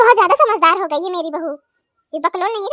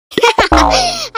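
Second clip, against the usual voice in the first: laugh; 3 dB louder than usual. Acted. Mother-in-law gloats.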